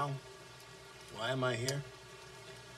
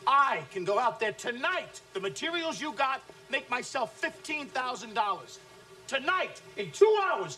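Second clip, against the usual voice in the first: boastful taunt; 8 semitones higher than usual. Acted. Boss brags at salesmen.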